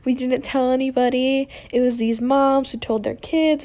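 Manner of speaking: a hint of a teen near tears pleading innocence; mild tearful pleading. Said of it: "I literally started crying".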